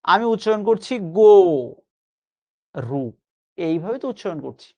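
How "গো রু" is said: long "গো", a pause, then "রু"; "গো ... রু" broken in two; syllable-by-syllable pronunciation. Shown as a demonstration. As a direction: stretch "গো" with a clear o, then drop "রু" separately. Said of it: "বাস্তবে আমি উচ্চারণ কি করছি".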